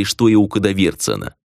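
usual speaking voice

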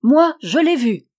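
haut et fort, bien net; ferme et claire. Acted. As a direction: affirme sans trembler. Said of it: "d'une voix ferme et claire". (le petit Jeannin, ferme et clair)

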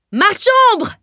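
very high, loud cry of alarm; sudden yell. A narrator's reading. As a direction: yell it out across the market. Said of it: "hurla soudain un marchand de bijoux".

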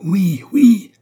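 high, very loud roaring crowd. A narrator's reading. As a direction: roar it out like a huge mob. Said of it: "rugirent ces trois mille bouches". (rugissement de foule)

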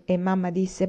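ordinary delivery

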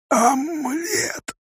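very high, slow, strained croak; croaked cry. A narrator's reading. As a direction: rasp it out as a desperate plea. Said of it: "прохрипел бедолага". (хриплый крик)

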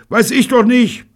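very high, exasperated shout; bellowing. A narrator's reading. Bunny answering Betty in the storm, bellowing. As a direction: yell it back at full force. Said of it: "brüllte Bunny".

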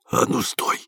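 unvoiced, menacing whisper from the dark; hushed whispered challenge; whispered command. A narrator's reading. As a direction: whisper a sudden order to halt. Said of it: "шёпотом сказали из темноты".

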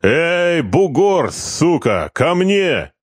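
high call out of a window. A narrator's reading. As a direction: yell to the foreman outside. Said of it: "крикнув в раскрытую форточку".

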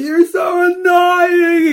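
high, drawn-out screaming tantrum; imitated scream. Regan screaming at his alter ego in the dressing room, imitated shriek. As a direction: shriek it at full volume, stretching the words. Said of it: "Der han skriker".

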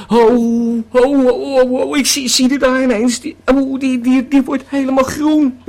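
hoger en harder, geschrokken roepend; geschrokken uitroep. Acted. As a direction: schrik je rot van wat je ziet. Schrikt van hallucinatie op strand. (geschrokken, hoger en harder roepen)

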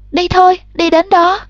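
high, pressing plea; urgent. A narrator's reading. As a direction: drag her mother along. Girl pulling mother to field. (giục giã khẩn cấp)

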